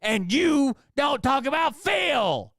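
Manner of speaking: high, slow, shouted mock donor; shrill mockery of an entitled donor, way up high; whiny donor impression. Streamer mocks donor who demands topics, in a high slow shout. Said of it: "when someone's like".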